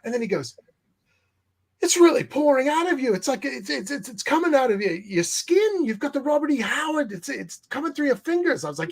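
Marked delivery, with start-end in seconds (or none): 1.8-8.6 high, rapturous praise voice; Richard raving. Retold or imitated. Richard telling Jim the writing pours out of him, high and rapturous. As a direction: pour the compliments out up high.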